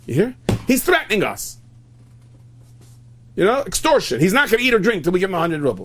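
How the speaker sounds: high, loud outrage; scandalized wife reporting a threat; indignant complaint. Acted. Acted wife complaining of extortion.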